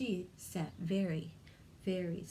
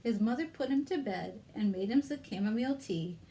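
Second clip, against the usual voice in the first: ordinary delivery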